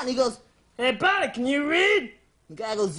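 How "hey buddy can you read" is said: lightly voiced officious ranger; challenging forest ranger. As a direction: put on a bossy ranger's challenge. Acts ranger challenging man.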